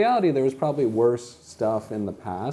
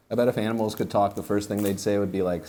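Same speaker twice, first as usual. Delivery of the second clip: normal talking voice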